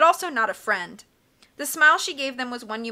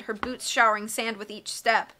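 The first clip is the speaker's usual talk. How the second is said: usual speaking voice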